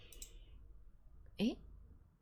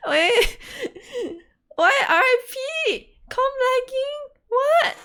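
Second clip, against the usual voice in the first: much higher and louder, laughing and shrieking; panicked shriek; 10 dB louder than usual. Real, not acted. Streamer shrieks as her stream starts lagging. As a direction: squeal in helpless tech panic.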